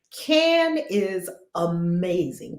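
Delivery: ordinary delivery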